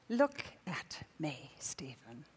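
slow, soft, almost a whisper at the end; gentle whisper. Retold or imitated. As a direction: coax him gently to look up. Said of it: "And he says".

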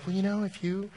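mock sweetness with a jab underneath; passive-aggressive. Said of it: "It was all passive aggressive".

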